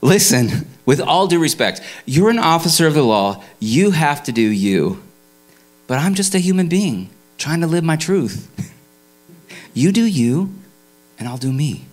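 hammed-up calm lecture to the officer; a lightly voiced Greg living his truth; Greg under arrest. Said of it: "He says".

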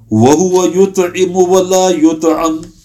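quick recited Arabic verse; Quran recitation. Acted. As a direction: recite the line in a quick flowing chant.

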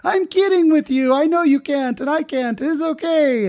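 very high, gleeful reassurance; Abdulia. Retold or imitated. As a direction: own up to the prank in a high, overjoyed voice. Driver admitting joke after laughing fit.

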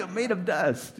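high, loud, mocking comeback; mock answer to the inner coach, high and loud. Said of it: "You know, like".

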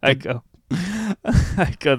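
real laughter breaking up the words; laughing. Real, not acted. Comedian laughing while retelling his own gig, laughing. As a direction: chuckle through it, slow.

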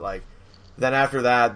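normal talking voice

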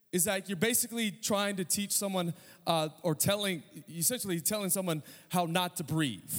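ordinary delivery

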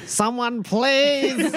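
very high, hammed-up cry for rescue; mock plea. Pestered guest begs someone to step in, mock pleading. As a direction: beg for help in a comically high voice.

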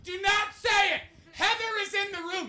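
high, loud mock outrage; over-the-top yelled protest; imitated panicked shout. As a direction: shriek it like a horrified ex cutting you off. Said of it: "He's like".

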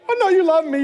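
praying to make the flight; earnest prayer. Retold or imitated. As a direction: plead with God earnestly. Prayer in the trolley.

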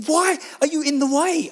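frantic exasperation. Retold or imitated. Frantic father pushing through crowd.

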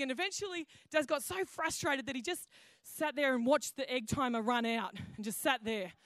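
ordinary delivery

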